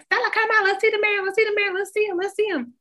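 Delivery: high, loud mock family chorus; clamoring family. Excited family knocking on Stella's car window, high clamoring. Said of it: "knocking on the fucking window like".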